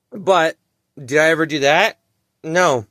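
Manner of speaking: usual speaking voice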